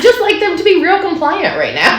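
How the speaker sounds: high-pitched, laughing confession; amused, squeaky wishful admission; joking plea. Real, not acted. Joking wish for obedient kids.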